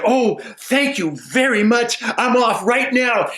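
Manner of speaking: eager simple man. Acted. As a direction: rush off grateful.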